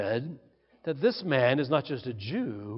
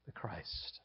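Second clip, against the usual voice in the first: hushed whisper; 9 semitones lower, 22 dB quieter than usual. Acted. Preacher whispering the climax to the congregation, hushed. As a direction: fade into a whisper on the last words.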